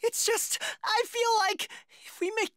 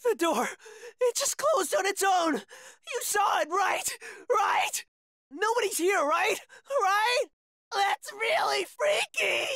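frantic, wailing need for reassurance; freaked out. Acted. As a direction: panic building to a drawn-out wail. Terrified as door closes by itself.

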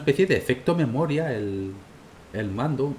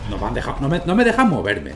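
frustrated complaint; 3 semitones higher, 6 dB louder than usual. Real, not acted. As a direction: complain loudly at the game. Frustrated at being stuck. (queja frustrada)

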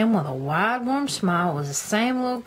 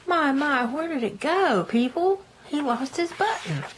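high, raised grandma calling out; 7 semitones higher than usual. Real, not acted. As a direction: call out high like a doting grandma. Reader calls out to listeners.

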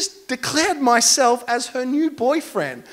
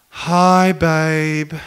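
lekceważące, znudzone "Hi, babe"; condescending; 8 semitones lower than usual. Retold or imitated. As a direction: imitate a bored, belittling boyfriend. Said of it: "a more condescending, belittling tone".

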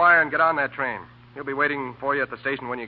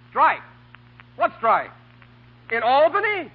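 surprised; 9 semitones higher, slower than usual. Acted. Alarmed on the phone.

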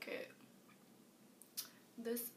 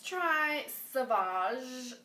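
slow announcement; 3 semitones higher, 8 dB louder, much slower than usual. Acted. Announces next shade dramatically.

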